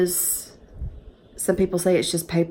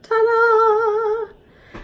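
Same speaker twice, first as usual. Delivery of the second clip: high, bright showman's reveal, much higher; triumphant ta-da; 4 dB louder than usual. Real, not acted. Vlogger unveiling her marriage license to viewers, triumphant ta-da. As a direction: pop it out like unveiling a prize.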